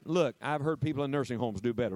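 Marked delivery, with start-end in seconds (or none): none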